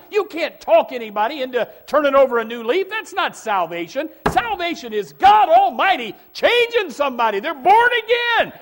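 high, loud, climbing preacher's cry; preaching at full force. Real, not acted. Preacher urging Christians to live beyond their power, shouting. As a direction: preach it out at the top of your voice. Peak of the sermon.

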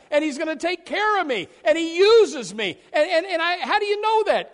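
high, loud rejoicing; ecstatic shouting. Acted. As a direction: shout your gladness to the rafters. Joyful believer preaching.

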